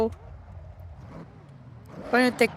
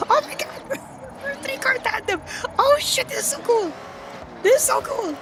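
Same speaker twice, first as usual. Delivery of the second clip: much higher, excited squeals; thrilled; 13 semitones higher than usual. Real, not acted. Streamer thrilled by a three-car tandem, excited squeals.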